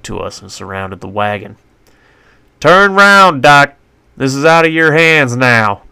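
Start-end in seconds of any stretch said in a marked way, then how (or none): 2.6-5.8 loud, higher shout over noise; commanding sheriff shout. A narrator's reading. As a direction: bark it over a charging posse. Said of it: "Sheriff shouted over the noise".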